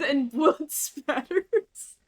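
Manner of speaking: laughing through the words; cracking up mid-sentence. Real, not acted. Breaks up laughing mid-quote.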